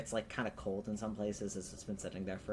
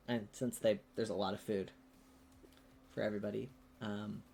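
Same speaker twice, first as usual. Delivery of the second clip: usual speaking voice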